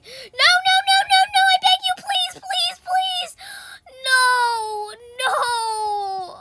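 high, loud panicked shrieks; rapid wail ending in long drawn-out cries; desperate pleading. Real, not acted. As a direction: beg frantically as you start to fall. Begging as she falls.

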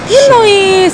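indignant surprise. Real, not acted. Indignant at being thanked.

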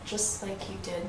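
bitter whisper. Acted. Bitter accusation in argument.

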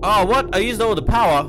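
high, loud alarmed outburst; suddenly panicked. Real, not acted. Power runs out.

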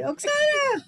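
high-pitched mock call for a child; imitated calling out. Imitating a parent calling a child home, high mock call. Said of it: "they would just call you".

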